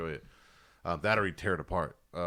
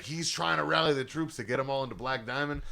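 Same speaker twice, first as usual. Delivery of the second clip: ordinary talk, nothing marked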